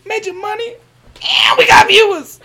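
high, excited mock cheer; triumphant. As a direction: crow about the win.

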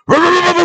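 rolling high mock roar; high imitated roar. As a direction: mimic a silly revving noise, way up high. Imitated noise in retelling.

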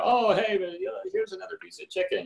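high, chirpy mock server offer; a breezy put-on restaurant server voice; imitating server. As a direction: lightly play the eager waiter. Server offering extra chicken.

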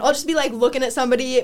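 normal talking voice

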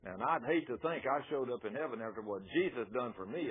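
usual speaking voice

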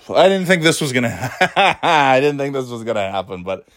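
breaking into real laughter mid-sentence; laughing. Real, not acted. Podcaster amused at their own rant, laughing. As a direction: laugh through the words.

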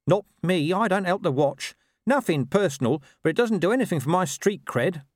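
high, streetwise dog voice; Gaspard. A narrator's reading. Dog refusing to help the watch, high and cocky. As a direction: refuse cockily, pitched well up.

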